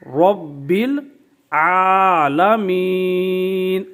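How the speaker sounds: العالمین drawn out very slowly; slow recitation. Shown as a demonstration. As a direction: chant the long عا in العالمین. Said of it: "اس طرح آپ نے کھڑی حرکت کو دراز کرنا ہے".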